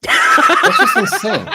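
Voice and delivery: slow, laughing disbelief, pitched high. Real, not acted. Host laughing at the guest's legal threat story, laughing disbelief. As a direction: laugh it out, stunned.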